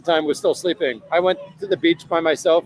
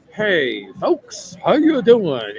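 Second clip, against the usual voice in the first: mock Alabama greeting, pitch leaping up, louder at the end; Southern Alabama accent; 3 dB louder, much slower than usual. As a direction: imitate a folksy Southern accent. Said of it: "that Southern from Alabama accent".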